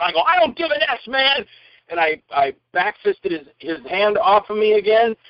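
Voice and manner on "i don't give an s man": high, heated retort at normal volume; mock angry defiance. Actor being dragged out talks back, mock angry. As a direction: act out defiant talking back. Retold scuffle, talking back.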